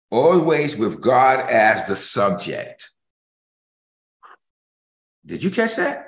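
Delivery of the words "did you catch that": teasing check. Real, not acted. Preacher checking his listeners.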